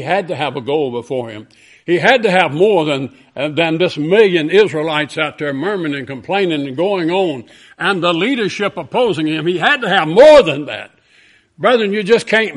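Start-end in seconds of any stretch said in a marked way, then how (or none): 1.8-9.5 louder, higher preacher's build-up; rising preaching. Acted. 9.5-10.9 full-force pulpit cry; loud preaching climax. Acted. Climax of repeated phrase.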